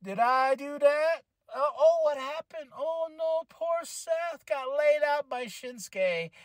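slow, smug mock innocence; swaggering Shinsuke. Vlogger voices Shinsuke gloating over Seth, slow smug swagger. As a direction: play it cocky and coy, drawn out. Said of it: "he got this swagger about it".

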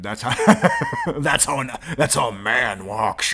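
laughing through the quote. Real, not acted. Streamer laughs repeating the teacher's line, loud laughing. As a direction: choke on laughter while repeating it.

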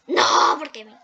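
ordinary delivery

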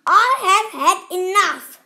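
high, loud yell with a laugh in it; furious yell. A narrator's reading. Captain fed up with the rats, yelling in fury. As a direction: shout it, patience gone. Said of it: "screamed the captain".

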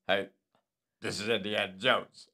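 low, lightly voiced adventurer; Indiana Jones. Host proving his impressions differ, low lightly voiced hero. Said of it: "This is my Indiana Jones".

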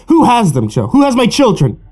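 put-on father desperate for his missing children; frantic demand. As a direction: shake the answer out of him.

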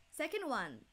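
ordinary talk

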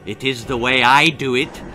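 leering doctor. Acted. Doctor leering at the girl, sly. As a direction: leer it with relish. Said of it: "leered Doctor Moribundus".

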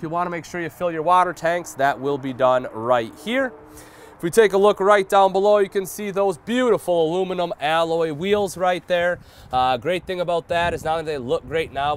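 usual speaking voice

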